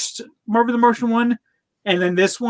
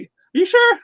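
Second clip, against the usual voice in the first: much higher quoted cartoon voice; Bugs Bunny; 3 dB louder than usual. Cartoon rabbit questioning the Martian, high mock voice. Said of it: "Bugs, like, he was like".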